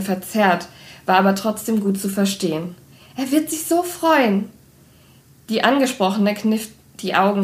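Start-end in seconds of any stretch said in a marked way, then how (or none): 3.2-4.5 high, delighted gush; giddy young woman, voice raised high. Acted. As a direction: gush about him, pitched well up. Blonde sister gushing.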